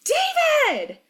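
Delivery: very high, panicked call; high, desperate yell; crying out. A narrator's reading. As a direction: scream the name for rescue. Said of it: "Brenna cries".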